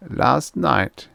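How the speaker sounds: put-on German accent. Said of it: "a German accent".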